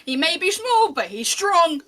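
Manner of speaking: loud, high barker's challenge; calling out. Acted. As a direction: call the crowd to step up, slowly. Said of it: "starts calling and going".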